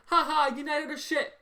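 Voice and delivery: high taunting singsong; lightly voiced jeering rival fan; mock taunting laugh. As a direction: mimic gloating rival fans. Mocking rival fans laughing.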